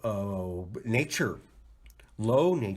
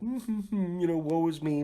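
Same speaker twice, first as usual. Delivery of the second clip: high, whiny woe-is-me; hammed-up sulking; mock self-pity; 7 semitones higher than usual. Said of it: "I was just like".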